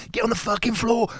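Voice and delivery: sharp, high barked order; retold shout. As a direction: yell it like a soldier storming a room. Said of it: "jumped out screaming and shouting".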